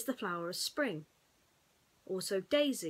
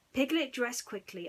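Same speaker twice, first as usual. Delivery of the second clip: ordinary delivery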